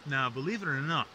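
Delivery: dramatic legend narration; myth teller. A narrator's reading. Myth retold, higher and louder.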